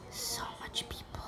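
awed hushed whisper. Real, not acted. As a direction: breathe it out in awe. Awed by crowd of players.

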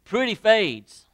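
high, loud and slow, leaning on Pretty; drawn-out dramatic declaration; slow punchline. Real, not acted. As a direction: land it like a preacher's zinger. Dramatic pause, then punchline.

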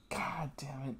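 soft, breathless curse under the breath; whispered curse. Real, not acted. After the twist, a whispered curse. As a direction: whisper it to yourself, defeated.

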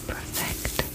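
ordinary delivery

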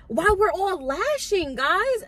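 high, loud burst of disbelief; indignant exclamation. Real, not acted. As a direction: raise pitch and volume, appalled at the bad timing. Indignant aside on confrontation during work.

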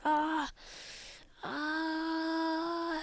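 high, held wail, kept soft; small drawn-out mock scream; acted screams. Trapped people cry out.

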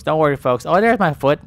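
normal talking voice

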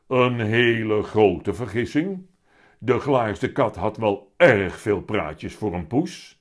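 loud, weighty cat voice; heavy-voiced talking cat. A narrator's reading. The cat explains himself to Willem, loud heavy voice. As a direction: pronounce it grandly as a big cat. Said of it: "met zware stem".

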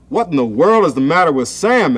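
father alarmed by the crying; father startled by crying; alarmed question. A narrator's reading.

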